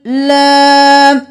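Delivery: slow, chanted tajweed recitation; Quranic recitation. Shown as a demonstration. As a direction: chant it slowly, stretching the vowels. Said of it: "एक अलिफ के बराबर खींचेंगे".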